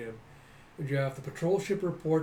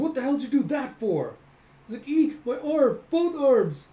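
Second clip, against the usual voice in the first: high, loud shrieked protest; panicked mock outrage; 11 semitones higher, 8 dB louder than usual. Boy whose arms were just smashed off, mock-panicked yelling. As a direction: screech it in hammed-up shock.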